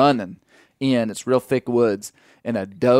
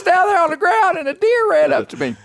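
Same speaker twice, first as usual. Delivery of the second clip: put-on much higher, fast, breathless kid voice; excited little boy; 3 dB louder than usual. Dad imitating his son telling about the deer, excited kid voice. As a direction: play the toddler bursting to tell Dad. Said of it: "it was just like he said".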